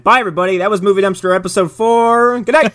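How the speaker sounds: mock sign-off. Co-host pretending to end Movie Dumpster early, mock sign-off. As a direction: wrap up the show jokingly, pitch climbing.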